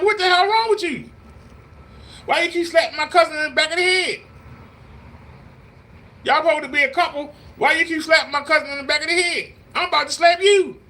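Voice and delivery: family member confronting. Retold or imitated. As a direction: tell him off for slapping your cousin. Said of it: "We're like".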